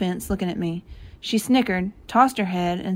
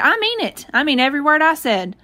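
indignant, pitched well up; upset grandpa; 6 semitones higher than usual. A narrator's reading. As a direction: insist like a miffed grandfather. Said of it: "Grandpa got a little upset".

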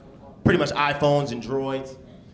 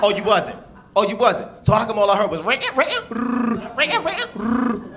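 high, louder mock accusation; imitating his ex; 8 semitones higher, 3 dB louder, slower than usual. Comic as his ex mocking the ringing, rising high. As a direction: ring the phone sound higher and higher. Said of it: "She, she said".

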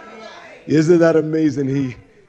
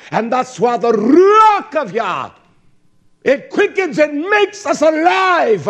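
fiery preaching; 7 semitones higher, 5 dB louder than usual. Acted. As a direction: lift the congregation with each line. Preaching builds to climax.

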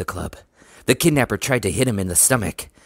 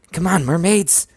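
pitched up, pressing them on; eager, higher-pitched prodding; urgent urging; 9 semitones higher, 4 dB louder than usual. A narrator's reading. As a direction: urge them on, high and anxious to get going. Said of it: "Sheena urged under her breath".

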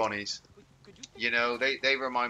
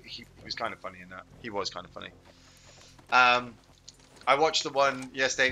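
usual speaking voice

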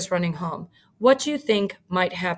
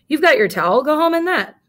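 teasing; 7 semitones higher, 7 dB louder, faster than usual. Acted. Spike teasing Bubba.

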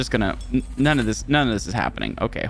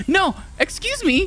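indignant; 14 semitones higher than usual. Real, not acted. Outraged at game.

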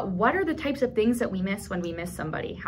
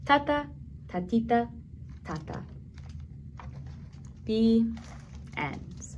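gentle story reading. A narrator's reading. Librarian reading to toddlers, gentle story narration. Closing lines of read-aloud book.

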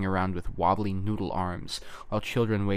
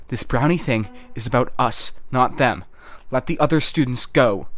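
loud, insistent demand; raised voice; 3 semitones higher, 6 dB louder than usual. A narrator's reading. Lucy demanding the other students be let go, raised voice. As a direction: raise your voice to make them listen. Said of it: "Lucy raised her voice".

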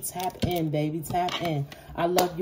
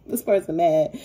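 ordinary talk, nothing marked

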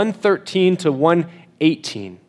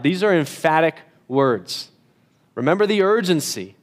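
normal talking voice

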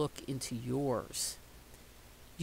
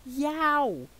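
startled yelp; 10 semitones higher, 5 dB louder than usual. Acted. Child startled by image.

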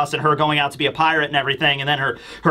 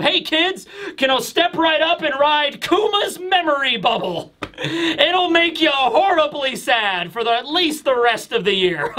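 much higher, hammed-up carnival pitch; mock ride barker; 9 semitones higher than usual. Joking to viewers about a Kuma memory ride, higher mock barker. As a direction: sell the sad ride like a cheesy barker.

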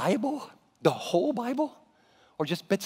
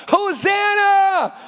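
high, slow, ringing cry of praise; joyful shout; 12 semitones higher, 9 dB louder, much slower than usual. Acted. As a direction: call it out to the crowd.

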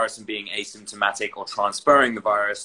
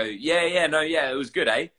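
higher-pitched mock Australian lilt; quasi-Australian; 5 semitones higher than usual. Teasing a friend's creeping accent, imitated quasi-Australian. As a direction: put on a half-Australian accent. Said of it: "this sort of quasi-Australian accent".

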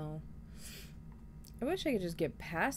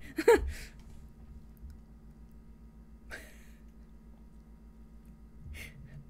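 high breathy laughter; 13 semitones higher, 4 dB quieter than usual. Real, not acted. Laughing at game.

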